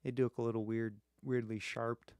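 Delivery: ordinary delivery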